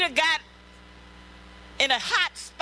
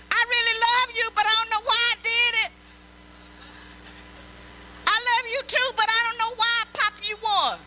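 higher-pitched whiny husband making excuses; mock abuser excuses; 7 semitones higher than usual. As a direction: play the sorry husband pleading innocence. Preacher voices abusive husband's excuses.

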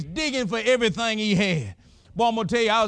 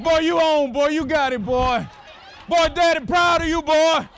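high, louder proud cheers; proud cheering; 8 semitones higher, 3 dB louder than usual. Retold or imitated. Father cheering his son from the stands, high proud shout.

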